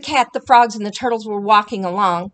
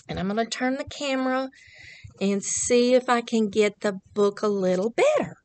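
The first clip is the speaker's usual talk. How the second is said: usual speaking voice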